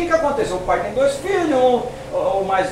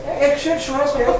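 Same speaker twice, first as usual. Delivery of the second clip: faster, mock-suspicious brother; a hint of a nosy older brother; older brother voiced; 5 semitones higher, 5 dB quieter, faster than usual. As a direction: ask it like the sniffing older brother. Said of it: "E ele fala assim mesmo".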